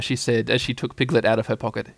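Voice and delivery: plain reading voice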